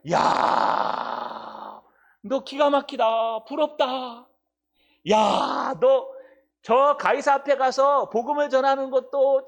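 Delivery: angel marvelling at Paul, excited; admiring angel. Acted. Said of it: "천사가 ... 감탄하면서". (바울에게 감탄하는 천사, 높고 큰 목소리)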